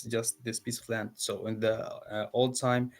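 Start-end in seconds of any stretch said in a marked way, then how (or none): none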